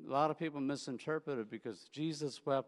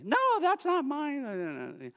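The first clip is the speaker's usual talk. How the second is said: put-on very high, slow, wailing kid refusal; child rejecting the parent's new candy; stubborn crying child; 6 dB louder, much slower than usual. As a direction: whine it like a kid who wants only his candy.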